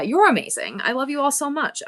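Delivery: usual speaking voice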